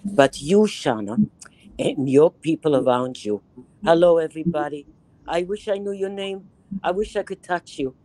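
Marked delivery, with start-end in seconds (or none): none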